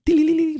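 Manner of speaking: high tinkling chime sound; crystalline tinkling. Shown as a demonstration. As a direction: sing a bright tinkle. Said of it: "a crystalline tinkling".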